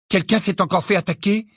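high, urgent questioning; alarmed question. A narrator's reading. Friend helping Harry up after a nightmare, alarmed.

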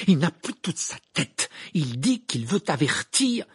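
high, loud, stretched-out plea; urgent insistence. A narrator's reading. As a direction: press the point desperately. Panicked Harry pleads with Snape.

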